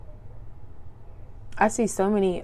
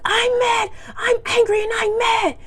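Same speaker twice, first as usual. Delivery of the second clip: very high, loud tantrum wail; mock screaming; 7 dB louder than usual. Imitating the angry mom at the school, mock screaming. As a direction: holler it like a raving, hammed-up tantrum. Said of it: "yelling and screaming, hollering, ramping and raving".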